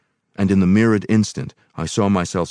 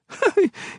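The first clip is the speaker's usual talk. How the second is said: high delighted laugh; a surprised, girlish laugh bursting out; burst of laughter. A narrator's reading. Woman surprised at herself, high bursting laugh. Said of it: "bursting into laughter".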